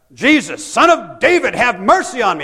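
loud, high desperate plea; crying out. Retold or imitated. Blind man crying out to Jesus, loud and high. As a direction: call out for mercy at full voice. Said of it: "Bartimaeus is crying out".

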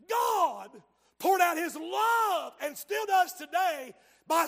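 loud, high revival shout; full-throated altar call; preaching at full force. Acted. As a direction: pour it out from the pulpit. Preaching climax.

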